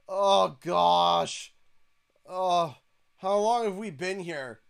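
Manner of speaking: bored whining. Retold or imitated. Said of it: "He's like".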